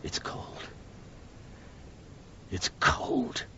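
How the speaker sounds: hushed unvoiced whisper. Ex-lover whispering in the cold office. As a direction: breathe it out in a whisper.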